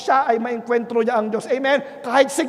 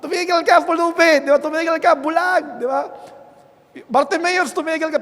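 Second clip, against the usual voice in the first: higher, louder scornful yells; crowd shouting; 6 semitones higher, 4 dB louder than usual. Retold or imitated. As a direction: shout the beggar down like a mob. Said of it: "libo yung sumisigaw sa kanya".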